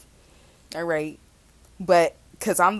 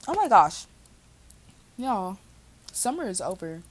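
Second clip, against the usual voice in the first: usual speaking voice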